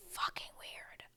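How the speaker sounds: unvoiced disgusted whisper. Real, not acted. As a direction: breathe it out in shock. Stunned aside.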